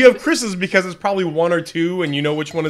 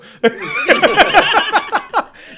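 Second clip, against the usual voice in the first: loud laugh; 6 dB louder than usual. Real, not acted. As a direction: burst of high laughter. Botched intro, group laughter.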